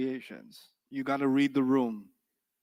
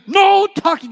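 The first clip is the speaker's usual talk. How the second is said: much higher, louder "No talking"; authoritative shout; 11 dB louder, slower than usual. Shown as a demonstration. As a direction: lay down the law. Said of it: "I had so much authority".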